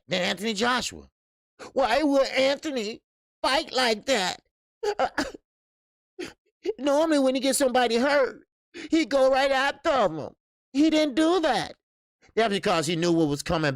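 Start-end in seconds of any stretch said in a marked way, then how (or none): 1.7-11.7 pitched way up in disbelief, quick at first; can't believe he didn't go after him; incredulous. Real, not acted. To viewers, ranting about the fight, incredulous and high.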